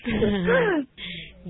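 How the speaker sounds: high, squealing laugh. Real, not acted. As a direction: let a high laugh burst out. Teacher laughing at student.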